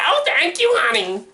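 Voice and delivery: put-on high, sweet old lady voice. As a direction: play a sweet granny pleased with the compliment. Old woman thanks, very high.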